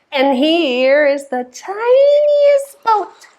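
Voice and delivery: slow, rising high on "tiniest"; tiny voice. Pretend. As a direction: stretch "tiniest" into a small high squeak. Voices tininess in pretend rhyme.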